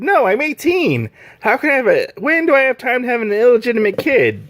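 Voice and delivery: defensive disbelief, squeaking up in pitch; indignant protest. Retold or imitated. Dale protesting to the tavern crowd, high and exasperated.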